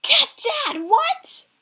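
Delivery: very high, loud shriek of embarrassment; mortified outcry. Acted. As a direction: squawk in horrified teenage protest. Son mortified by dad's advice.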